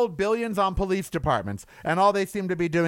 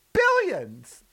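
ordinary talk

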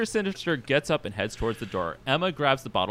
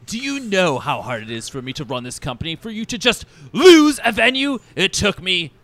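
rising to high, loud outrage; outraged diva boss; 7 semitones higher, 7 dB louder than usual. Acted. Boss berating staff over the venue, rising theatrical outrage. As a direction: build a pompous furious rant.

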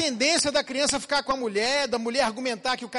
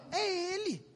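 whiny blaming wife; 8 semitones higher, 9 dB quieter, slower than usual. Retold or imitated. Wife blames husband to pastor.

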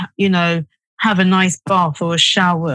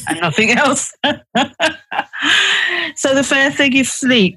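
real laugh breaking into the words, pitched higher; 8 semitones higher than usual. Real, not acted. Speaker amused after saying bedroom is for sleep or sex, laughing. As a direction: talk through a genuine chuckle.

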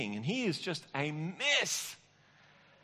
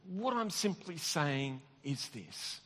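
ordinary talk

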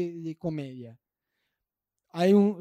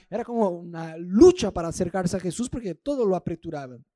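ordinary delivery

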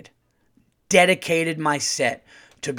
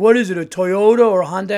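heckler yelling from the back; 5 semitones higher, 7 dB louder than usual. Retold or imitated. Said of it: "the guy in the back would be like".

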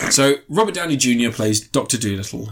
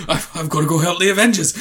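mock hero; 3 semitones higher, faster than usual. Mocks Doolittle as Iron Man.

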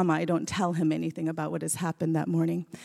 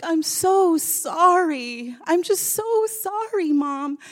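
slow, much higher sobbing plea; retold crying apology; 11 semitones higher, 5 dB louder, much slower than usual. Caius apologizing to mom, crying. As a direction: sob out the apology like a child. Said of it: "crying so hard".

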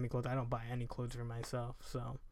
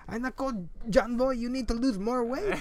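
higher, louder Filipino uncle scolding; mock Filipino uncle, higher and louder; 9 semitones higher, 7 dB louder than usual. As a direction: Filipino accent, teasing nephew about weight. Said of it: "he's gonna be like".

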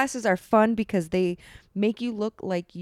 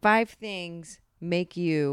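slow deliberate reading; much slower than usual. Acted. Reading the title word by word.

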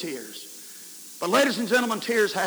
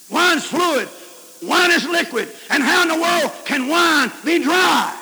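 high, loud arguing protest; incredulous shout; 7 semitones higher, 5 dB louder than usual. Retold or imitated. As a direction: demand an answer from above.

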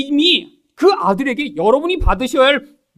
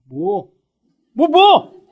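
acted defiant retort; 5 dB louder, much slower than usual. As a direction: play the unrepentant spouse next morning. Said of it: "그렇게 해놓고도 아침에".

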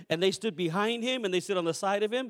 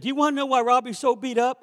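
put-on kid's voice; 6 semitones higher, 7 dB louder than usual. Kid revealing secret.

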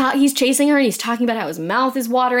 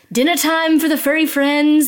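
lightly put-on raised, louder singsong; monster's feeding call; 5 semitones higher, 4 dB louder than usual. Librarian monster calling his pets to dinner, mock singsong.

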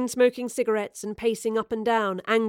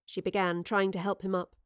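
plain reading voice

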